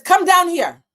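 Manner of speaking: loud call from another room; yelled summons. Retold or imitated. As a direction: yell it down from the kitchen. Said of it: "He yells at me from the kitchen".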